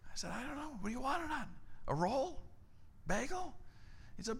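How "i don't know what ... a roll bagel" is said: higher, softer exasperated questions; fed up but polite; strained patience. Retold or imitated. Exasperated reply to pastor.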